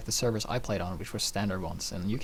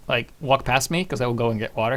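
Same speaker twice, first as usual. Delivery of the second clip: normal talking voice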